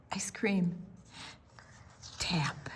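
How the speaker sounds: soft hushed; whisper. A narrator's reading. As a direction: whisper gently. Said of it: "unvoiced whisper".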